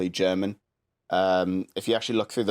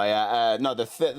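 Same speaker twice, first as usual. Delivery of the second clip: normal talking voice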